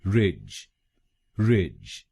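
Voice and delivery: रिज said as rij; teacher isolates रिज; syllable drill. Shown as a demonstration. Teacher drills students on the second syllable, rij. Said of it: "हम बोलेंगे".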